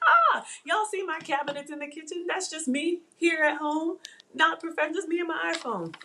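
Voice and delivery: very high, light posing voice; airy high-pitched mock modesty; playful self-quote. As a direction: act out a cutesy photo moment. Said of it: "And I was like".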